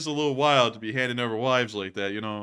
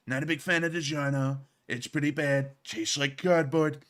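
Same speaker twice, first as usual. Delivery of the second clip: alternate comedy voice; 2 semitones higher than usual. Pretend. Host offering chat a different voice to rant about DiGiorno, put-on character voice. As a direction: slip into a different comic persona and complain. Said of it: "would you like it better if I did this one".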